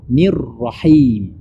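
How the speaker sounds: "Nir Rahim" with a long rolled R; slow "Nir Rahim", trilling where the words join. Shown as a demonstration. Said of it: "Jadi, ke huruf ra".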